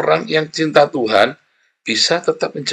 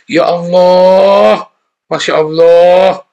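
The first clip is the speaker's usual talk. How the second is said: slow, loud, drawn-out "Ya Allah, Masya Allah"; mock pious exclamations; 6 semitones higher, 8 dB louder, much slower than usual. Preacher mimicking churchgoers' habit, slow loud exaggerated sigh. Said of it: "omongan-omongan seperti itu".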